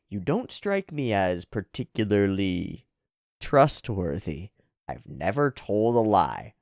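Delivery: low, measured, wary delivery; distrustful character. Acted. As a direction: weigh the words with suspicion. Manga characters weigh each other up.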